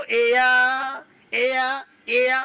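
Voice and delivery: एया very drawn out, gliding EH into AA; EYA sound. Shown as a demonstration. Teacher modelling a third sound of A, stretched EYA. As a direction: stretch एया slowly and repeat it. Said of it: "एया भी बोलते हो".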